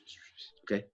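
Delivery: hushed unvoiced shushing; whisper. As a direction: shush them, secretive. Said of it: "they're whispering".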